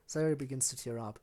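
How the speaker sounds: ordinary delivery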